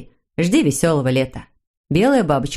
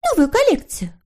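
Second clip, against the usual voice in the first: small, high-pitched questioning voice; high character voice; 12 semitones higher than usual. Acted. Story character asking about a new collection, high little voice.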